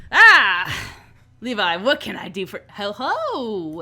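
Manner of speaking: loud, high burst of welcome; theatrical, intrigued boss; exuberant boss greeting. Acted. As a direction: greet with a booming flourish, then a drawn hello. Vera flings door open, greets.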